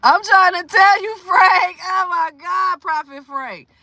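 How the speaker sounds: high, loud, exasperated amazement; emphatic agreement. Real, not acted. Agreeing with Frank about the shocking story, emphatic. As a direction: agree loudly, utterly astounded.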